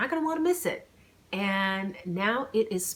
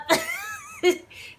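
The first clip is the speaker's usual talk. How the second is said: high, squealing laugh; high burst of laughter. Real, not acted. Author laughing about her hero messing up.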